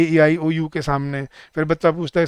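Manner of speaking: usual speaking voice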